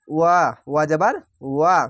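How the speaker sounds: ordinary talk, nothing marked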